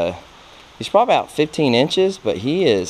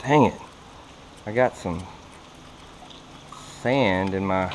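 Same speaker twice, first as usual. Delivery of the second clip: ordinary talk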